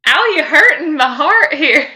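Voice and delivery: ordinary talk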